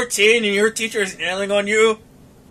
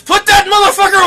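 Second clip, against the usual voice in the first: high, loud, appalled yell; mock alarmed shout; 9 semitones higher, 6 dB louder than usual. Brother's first response to the sight, mock alarmed shout. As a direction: bellow the order in comic shock.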